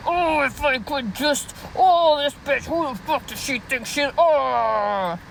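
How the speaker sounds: hammed-up sputtering frustration; mock fuming viewer. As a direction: imitate a hater seething helplessly.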